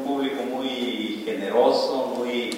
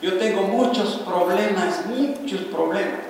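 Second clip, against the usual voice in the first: higher, slow, hesitant whine; timid self-doubter; 6 semitones higher, much slower than usual. Retold or imitated. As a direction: play someone who thinks he is too small. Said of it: "así piensan muchos".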